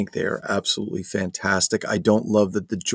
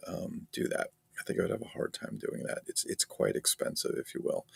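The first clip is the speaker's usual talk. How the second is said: quiet; 11 dB quieter than usual. Real, not acted. Trailing into soft aside.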